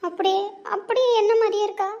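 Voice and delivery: stunned at seeing her double; amazed gasp. Acted. Meets lookalike sister, amazed.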